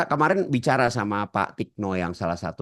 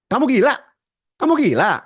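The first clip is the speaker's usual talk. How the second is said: shouted, much higher and louder; angry shout; 12 semitones higher, 7 dB louder, faster than usual. Shown as a demonstration. Trainer demos the insult shouted at the class. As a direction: yell it like an accusation. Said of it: "Itu beda-beda persepsinya".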